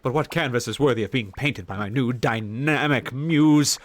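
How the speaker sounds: hammed-up noble Smeargle voice; grand character voice. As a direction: declaim like a lofty painter. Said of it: "I need to give this guy a voice".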